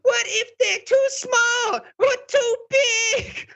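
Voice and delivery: very high, tearful drama queen wail. Acted. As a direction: wail it like a stage diva close to tears. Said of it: "this is my drama queen voice".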